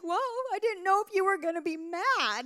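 mock wail, high and loud; 假装哭诉. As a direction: whine it high like an overwrought wife. Retold tearful exchange with husband.

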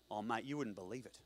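low, soft Australian mate; Australian accent. Acted. One-legged man answers a question, Australian accent. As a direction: answer in broad laid-back Australian. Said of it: "that's an Australian accent".

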